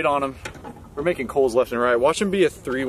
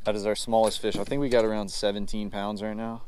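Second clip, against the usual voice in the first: ordinary talk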